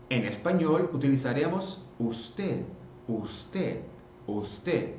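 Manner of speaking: usted with the final D silent, ending uste; usted sin d final. Shown as a demonstration. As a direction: fade usted, soft and final D dropped. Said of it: "Esse D no final mudo".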